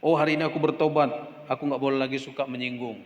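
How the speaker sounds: ordinary talk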